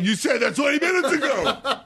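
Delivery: high, laughing disbelief; laughing exasperation. Retold or imitated. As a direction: throw their words back at them. Exasperated at staff.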